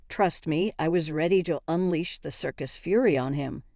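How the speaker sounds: usual speaking voice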